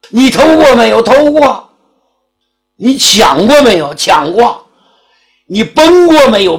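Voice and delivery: high, loud, rapid-fire questions; interrogating magistrate. Acted. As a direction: press them like a judge. (县官连声逼问)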